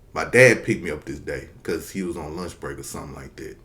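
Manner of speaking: usual speaking voice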